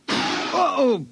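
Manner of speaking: sudden high yelp of pain; startled yelp. Acted. Visitor yelps as the cat scratches his hand, startled.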